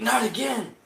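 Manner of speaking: high, loud "Not again!"; hammed-up wail of annoyance; mock cry of dismay. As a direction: yelp it in mock despair. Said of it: "It's like".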